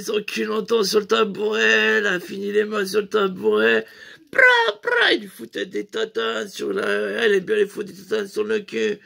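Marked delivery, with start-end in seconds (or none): 4.3-5.1 high, loud imitated slap sounds; slap noises. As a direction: mimic smacks with your voice.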